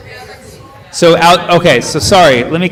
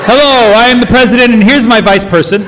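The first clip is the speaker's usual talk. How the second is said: a hammed-up pompous president, higher and louder, laughing through it; mock president; 9 semitones higher, 5 dB louder than usual. Comic to the Dutch crowd, mocking a grand presidential introduction. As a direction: announce your vice person like a self-important head of state.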